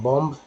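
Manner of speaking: बॉम्ब with the final ब pronounced; wrong-way बॉम्ब, closing on an audible b; sounded final b. Shown as a demonstration. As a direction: let the b be heard ending बॉम्ब. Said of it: "ब जब बोलते हैं तो उच्चारण गलत".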